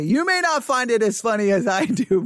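normal talking voice

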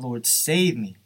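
loud plea, read aloud; crying out. A narrator's reading. Preacher reading Peter's plea as he sinks, crying out. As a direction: raise the voice as a reader quoting a cry. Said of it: "He cried out".

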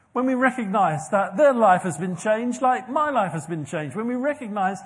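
loud, rising sermon climax; preaching at full force. Acted. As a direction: drive each "when we recognise" home.

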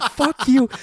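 high, indignant retort; playful high-pitched fuck you; mock outrage. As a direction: fire it back at a laughing mom. Mom laughing at his news.

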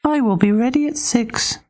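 very high pig voice; squeaky little pig. Acted. Pig voice in story.

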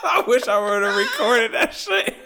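high voice breaking into laughter; laughing hard. Real, not acted. Laughing while telling friend about cousin. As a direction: wheeze it out through laughing.

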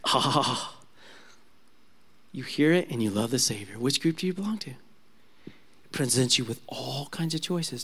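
soft, hushed preacher's invitation; quiet altar call. Acted. Preacher gently inviting listeners to surrender, soft and hushed. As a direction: draw the listener in gently, almost confiding.

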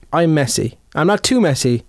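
ordinary delivery

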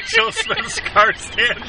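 very high, slow, through giggles; laughing. Real, not acted. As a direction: squeak it out laughing.

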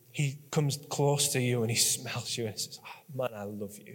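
hushed loving murmur; tender whisper of love. Acted. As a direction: breathe it out like a father to a newborn. God smelling his child.

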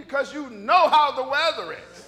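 high, loud, drawn-out mock outrage; angry comeback. Preacher answering why he gets so angry, mock-furious. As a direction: hammer it out slowly, comically fed up. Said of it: "Why you get so angry".

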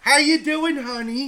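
very high falsetto mom; mock mom voice. Mom checks on son from the kitchen, mock falsetto. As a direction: call out sweetly in a squeaky falsetto.

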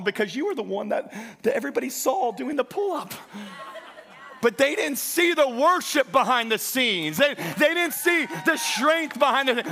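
loud, high, driving sermon; full-force preaching. Acted. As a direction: preach it hard to the congregation.

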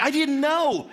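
high-pitched comic protest; mock protest. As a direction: pitch it up, pleading innocence. Acted reply of embarrassed friend.

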